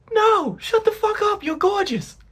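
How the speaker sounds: high-pitched, mock-outraged reassurance; exaggerated boyfriend insisting; emphatic protest. Acted quarrel, boyfriend protesting.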